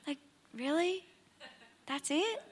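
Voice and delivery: deflated, as if cheated of a miracle; disappointed disbelief. Pretend. Pastor voicing the friends' letdown, soft and incredulous. Mock letdown at Jesus' answer.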